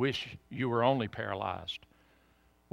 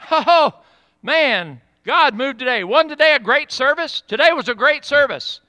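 loud, high gushing over the service; excited churchgoer; 11 semitones higher, 11 dB louder than usual. Retold or imitated. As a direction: imitate a thrilled spectator after church.

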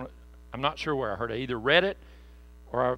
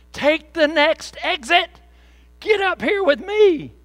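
much higher, louder, slow calling out; screaming down; 14 semitones higher, 12 dB louder, slower than usual. Pretend. Believers calling down to friends on the broad road, shouting. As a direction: yell up the hill to a friend far below. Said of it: "we are supposed to be screaming down".

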